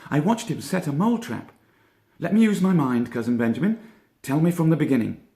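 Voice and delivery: high, brisk thoughtful rabbit; Peter Rabbit voice. A narrator's reading. Peter telling Benjamin what he saw, high brisk voice. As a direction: keep the cousin's voice up and businesslike.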